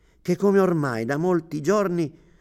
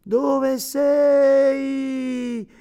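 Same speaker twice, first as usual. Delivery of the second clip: lamento acuto e lento, in lacrime; voce rotta dal pianto; 12 semitones higher, 6 dB louder, much slower than usual. A narrator's reading. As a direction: implora piangendo, molto più acuto e lento. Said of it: "una voce rotta dal pianto". (uomo in lacrime che implora la moglie perduta, voce rotta e acuta)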